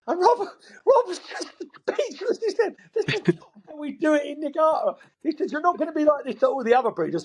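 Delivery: high, squeaky-pitched telling through real laughter; laughing retelling. Real, not acted. Storyteller retelling his colleague's speechless protest at the deal, laughing. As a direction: struggle to get the words out for laughing.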